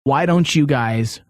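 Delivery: ordinary delivery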